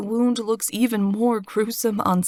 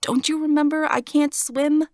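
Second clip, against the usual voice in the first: alarmed protest; 8 semitones higher than usual. Acted.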